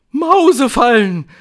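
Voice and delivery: high, loud crowd groan; moaning witches. A narrator's reading. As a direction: moan it out loudly as a coven. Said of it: "stöhnten die Hexen".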